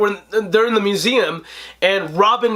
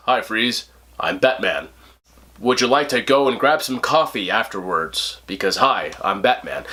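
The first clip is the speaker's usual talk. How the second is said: deep lazy Batman imitation, lower pitch; mock George Clooney; 8 semitones lower than usual. Reviewer mocking Clooney's Batman line, deep lazy voice. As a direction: sends up the actor's flat hero line. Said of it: "and he says".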